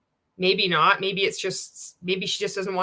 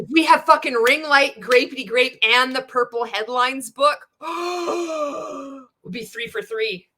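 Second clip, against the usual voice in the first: higher, louder gleeful outburst with a gasp; excited shout; 6 semitones higher, 5 dB louder than usual. Real, not acted. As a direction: shout it like you hit the jackpot. Gleeful outburst about the ring light.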